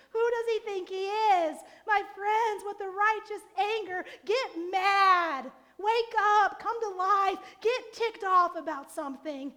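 loud, driving call to action; rousing preaching. Acted. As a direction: rouse the congregation to wake up. Sermon climax, rallying congregation.

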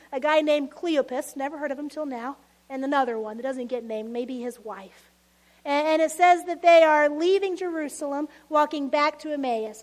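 ordinary talk, nothing marked

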